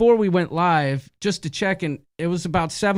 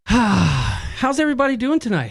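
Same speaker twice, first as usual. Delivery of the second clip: ordinary delivery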